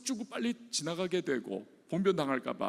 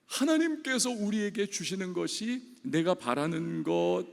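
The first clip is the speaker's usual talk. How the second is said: usual speaking voice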